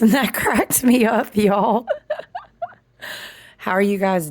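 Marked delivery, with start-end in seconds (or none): 0.0-1.9 laughing through the words; real giggles breaking into the line. Real, not acted. Laughing at friend's comment. 1.9-2.4 short high-pitched laugh; real amused little laugh; high giggle. Real, not acted. Laughing at friend's comment.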